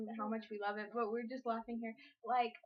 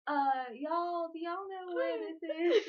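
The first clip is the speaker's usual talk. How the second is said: high, slow Texan drawl; Texan accent; 6 semitones higher, 5 dB louder, much slower than usual. Pretend. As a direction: play a lost Texan tourist. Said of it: "Texan accent".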